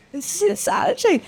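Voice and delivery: high, disbelieving exclamation; incredulous. Retold or imitated. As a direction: squeak out the disbelief. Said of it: "And I'm just".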